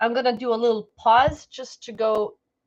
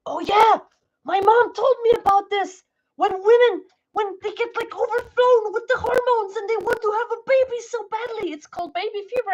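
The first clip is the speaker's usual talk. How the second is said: excited retelling; 8 semitones higher than usual. Retold or imitated. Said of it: "And I'm like".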